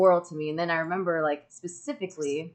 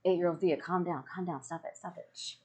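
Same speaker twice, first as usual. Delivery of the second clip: fading from plain to a hushed shh; calm yourself down, dropping softer each time; hushing herself; 8 dB quieter than usual. Retold or imitated. Telling her inner child to calm down, fading to a hush. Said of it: "and I was like".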